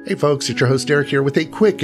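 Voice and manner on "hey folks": normal talking voice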